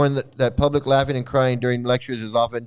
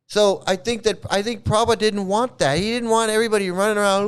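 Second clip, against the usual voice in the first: normal talking voice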